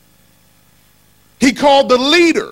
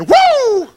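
high drawn-out "woo"; long whoop leaping up in pitch; excited whoop; 13 semitones higher, 4 dB louder than usual. Acted. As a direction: let out a high, playful whoop. Playful exclamation to congregation.

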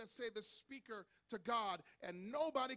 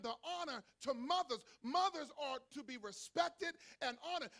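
high, loud, emphatic sermon; full-force preaching; 6 semitones higher, 8 dB louder than usual. Real, not acted. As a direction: thunder it out to the congregation.

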